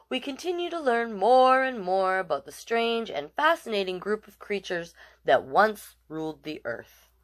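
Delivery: ordinary delivery, nothing marked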